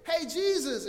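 high, loud call; acted shout to rouse a sleeper; calling to wake someone. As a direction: call out urgently to the sleeping teacher. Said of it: "they're trying to wake".

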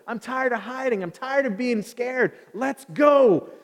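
high, loud battle pep talk; fired-up rallying. Acted. Jonathan tired of hiding, rallying his armor bearer, fired up. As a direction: rouse your companion to charge. Acting Jonathan's bold faith before attack.

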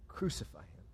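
whispered, unvoiced crowd cry; a whispered crucify him. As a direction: breathe it out as a hushed echo.

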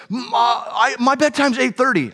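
high, indignant imitation of a kid; protesting child. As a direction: argue against bedtime like an upset daughter. Acted daughter arguing about bedtime.

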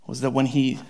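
normal talking voice

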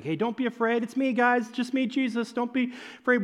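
lightly mocking casual reading; mock breezy Jesus. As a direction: toss it off like it's no big deal. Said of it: "we're like".

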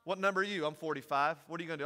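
normal talking voice

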